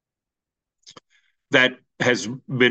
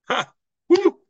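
short laugh into a high loud woo; joyful laugh and whoop; 4 dB louder than usual. Real, not acted. Pastor celebrating before the congregation, joyful whoop. Preacher's exultant whoop after climax.

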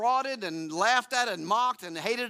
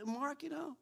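higher and softer, a quiet divine nudge; a gentle prod from the Lord, much softer; Lord's gentle nudge; 7 semitones higher, 9 dB quieter than usual. Retold or imitated.

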